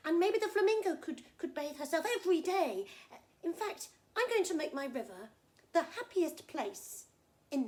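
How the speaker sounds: high, loud, excited crocodile; delighted crocodile. Acted. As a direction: gush with new plans, tripping over the words. Crocodile delighted, plans to share.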